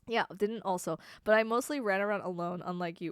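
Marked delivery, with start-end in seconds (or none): none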